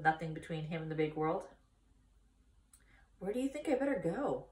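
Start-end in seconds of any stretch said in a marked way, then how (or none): 3.2-4.5 1 unsure pig. Acted. Wilbur voiced, unsure.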